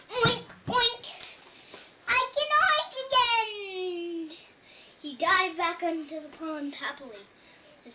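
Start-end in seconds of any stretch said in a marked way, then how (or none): 0.1-1.1 high, loud pig oinks; piglet's acted happy oinking. 2.1-4.4 high, loud, drawn-out rejoicing; acted triumph, stretched long; joyful piglet cry. Pig rejoices at restored oink.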